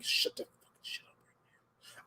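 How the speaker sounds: whispered, drawn-out mock scolding; stern daddy voice. Warning a cougher on the plane, stern whispered daddy voice. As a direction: hiss it low like a stern dad. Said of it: "I'll be giving them that daddy voice".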